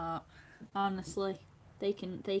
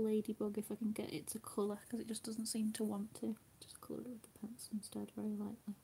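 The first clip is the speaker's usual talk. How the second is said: soft whispering while colouring; 9 dB quieter than usual. Real, not acted. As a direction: whisper to yourself as you work. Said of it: "crafting quietly".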